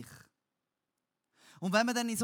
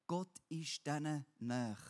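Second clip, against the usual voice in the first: normal talking voice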